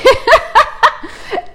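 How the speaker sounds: high burst of giggling; high laugh. Real, not acted. Daughter laughing after retelling mom's words.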